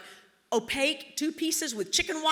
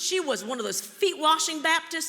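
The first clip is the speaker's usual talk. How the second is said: ordinary talk, nothing marked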